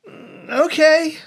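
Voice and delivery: drawn-out, high-pitched grudging okay; reluctant agreement. Retold or imitated. Date giving in to the one-date plea, reluctant. Date's reluctant answer, acted.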